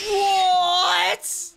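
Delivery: high, drawn-out cry of disbelief; incredulous half-shout; astonished. Real, not acted. Startled reaction to cat clip.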